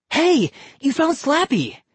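high, bright exclamation; a boy thrilled his dummy is found; excited cry. Acted. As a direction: burst out gladly. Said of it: "he cried".

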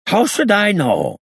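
put-on high, drawn-out accented protest; angry gangster. A narrator's reading. Freed gangster snapping back at the inspector, high angry voice. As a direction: protest angrily, pitched well above your own voice. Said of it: "he said angrily".

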